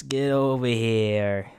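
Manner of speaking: slow, drawn-out Italian-American mobster call; Paulie Walnuts. Acted. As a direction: beckon slowly like an Italian-American wise guy. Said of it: "Paulie Walnuts".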